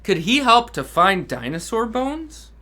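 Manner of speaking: ordinary delivery, nothing marked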